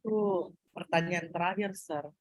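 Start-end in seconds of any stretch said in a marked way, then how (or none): none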